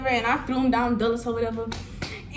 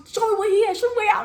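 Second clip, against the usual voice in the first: friends demanding; 10 semitones higher, 4 dB louder, much faster than usual. Retold or imitated. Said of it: "They're like".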